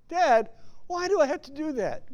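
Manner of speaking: put-on high kid's complaint to dad; whiny child. Kid whining at dad about chores, high-pitched. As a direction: whine it up like a small child.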